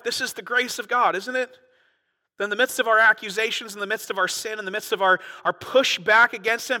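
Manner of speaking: preaching at full force. Acted. Preacher rousing his congregation, full-throated and loud. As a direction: build the outrage to the back row.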